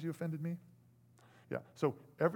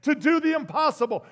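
nervous plea; 10 semitones higher, 13 dB louder than usual. Retold or imitated. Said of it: "I'm kinda going".

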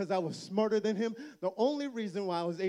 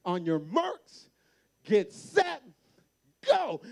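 slow marks then a high, loud go; race start; 5 semitones higher, 5 dB louder, slower than usual. Retold or imitated. Brother starting the race, building to a loud shout. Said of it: "he's like".

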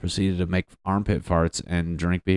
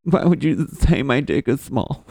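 mock offended friend, pitched up; a hurt guy complaining, lightly hammed; whiny hurt dude; 5 semitones higher than usual. Buddy whining after being ball-busted, mock hurt. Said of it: "and they're just like".

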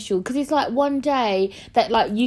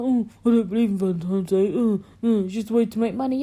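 lightly voiced grumbling man; a hint of mock male moaning; mocking men; 2 semitones lower, faster than usual. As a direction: do a slight send-up of dismissive men. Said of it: "men, yeah, they're always like".

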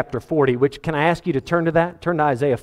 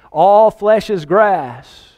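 loud, very slow, higher-pitched proclaiming; preacher's proclamation; 4 semitones higher, 5 dB louder, much slower than usual. Acted. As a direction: cry it out, stretching the opening word. Acting out the cried-out message.

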